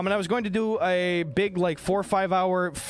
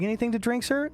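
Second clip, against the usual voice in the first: stewardess; 7 semitones higher than usual. Acted. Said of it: "stewardess comes around and is just like".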